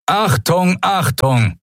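high, urgent loudspeaker alarm; acted booming announcer; thundering announcement. A narrator's reading. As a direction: call it out like a PA alert. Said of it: "donnerte plötzlich die Stimme eines Menschen".

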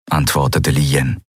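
plain reading voice

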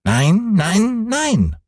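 put-on high, insistent little boy's cry; Häwelmann crying out. A narrator's reading. Boy refusing the old moon, high childish cry. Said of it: "schrie der kleine Häwelmann".

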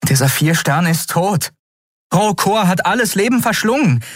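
high, urgent objection; insist, pitch climbing sharply; agitated insistence. A narrator's reading. Woman tells the captive his world is dead, agitated.

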